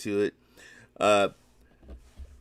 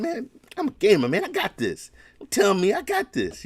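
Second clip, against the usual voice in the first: cocky gamer; 10 semitones higher than usual. Retold or imitated. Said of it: "He was telling Rev".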